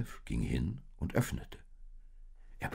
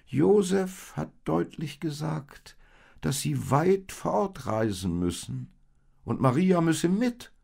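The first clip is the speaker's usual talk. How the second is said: sheep voice; 12 semitones higher, 7 dB louder than usual. Acted. Oldest sheep explains Josef's journey to the lambs, higher. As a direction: explain it to the little ones.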